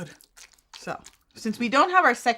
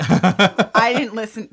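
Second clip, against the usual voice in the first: laughing; 10 dB louder, much slower than usual. Real, not acted.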